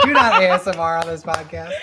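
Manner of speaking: loud, laughing, emphatic ban; each word spelled out through laughter; laughing command. Real, not acted. Repeated laughing order.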